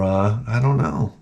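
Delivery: ordinary talk, nothing marked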